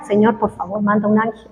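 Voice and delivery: a desperate prayer under the breath; hushed plea. Retold or imitated. Repite su oración desesperada. (ruego en voz baja y grave)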